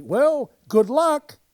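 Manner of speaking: loud, high, hearty good wishes; eager novice cheering everyone on; cheery. Retold or imitated. New producer addressing the cast, loud hearty cheer.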